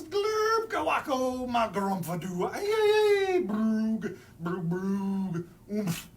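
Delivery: loud wild gibberish wails; silly noises. Acted. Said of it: "make silly sounds like".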